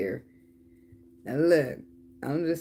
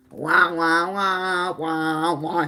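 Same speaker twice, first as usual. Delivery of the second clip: mock crying; 3 semitones higher, 6 dB louder, much slower than usual. Mocking her as a crybaby.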